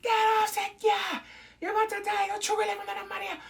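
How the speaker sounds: very high, loud warning cry; high panicky shout; mock yelling at screen. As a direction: cry out to save him.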